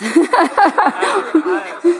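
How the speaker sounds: real rolling laughter, rising high; hearty laugh. Real, not acted. Pastor teasing the guitar player as a mess, hearty laugh. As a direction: burst into a run of laughs.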